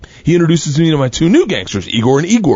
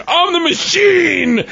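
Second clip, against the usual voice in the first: high, drawn-out mock shout; stretched high-pitched proclamation; triumphant yell; 14 semitones higher, much slower than usual. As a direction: cry it out long and high. Retold triumphant yell to gangster.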